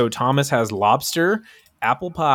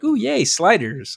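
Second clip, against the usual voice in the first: mock excitement; 9 semitones higher, slower than usual. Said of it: "I'm never gonna go to a restaurant and be like".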